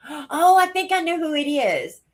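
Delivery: gasp, then a high excited realization; sudden realization. Real, not acted. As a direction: it just clicked, blurt it out.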